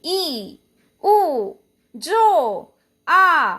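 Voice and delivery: ई, उ, जो, आ each drawn out with force; stressed long vowels. Shown as a demonstration. Teacher showing long vowels to students, ई, उ, जो, आ held and stressed. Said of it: "इनका उच्चारण करते वक्त कंठ पर जोर आता है".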